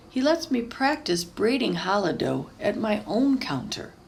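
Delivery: usual speaking voice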